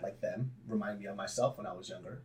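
usual speaking voice

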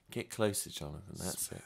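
low, hushed mock-intimate voice; mock-sultry radio murmur; close-mic podcast voice. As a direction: coax them closer, dropping soft and low. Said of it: "Nice and close to the mic".

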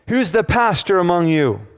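high, loud, harsh demand from an armed rebel; menacing rebel demand. Retold or imitated. Rebels in the church demand the pastor, loud and menacing. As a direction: bark it like a gunman hunting the pastor.

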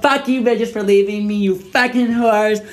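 put-on high, whining drunken tirade; hammed-up high-pitched outrage, words dragged out; mock angry rant. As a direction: wail accusations at friends who left you. Acting out angry drunken audio message.

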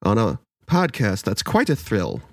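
slow rhyming storyteller intro; storybook reading voice. Acted. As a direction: recite it like a bedtime story host. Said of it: "read his notes the way I read the children's book".

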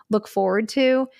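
ordinary delivery

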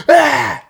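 loud, high imitation of a mad baby; angry baby shriek. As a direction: mimic a toddler's outburst of temper. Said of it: "He got mad at the mom".

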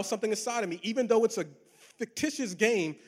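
normal talking voice

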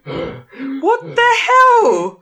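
high, very slow what the HELL; drawn-out disbelief. Real, not acted. Friend hearing the gym story reacts in slow, high disbelief. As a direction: stretch it out in total disbelief.

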